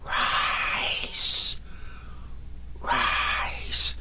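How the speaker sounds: hushed, breathy skeleton command; ghostly command. Acted. Skeleton whispering a spell over the sofa. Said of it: "It looked into space and said".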